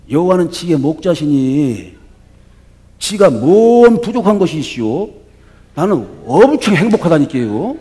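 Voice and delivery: a light Chungcheong-dialect psalm; lightly played Chungcheong dialect recitation. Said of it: "이 사투리 버전을 듣고 충청도 사람이".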